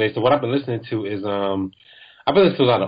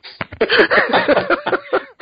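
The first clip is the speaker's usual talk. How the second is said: real high giggling fit; helpless laughter; 3 dB louder than usual. Real, not acted. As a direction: crack up helplessly. Cracks up at botched intro.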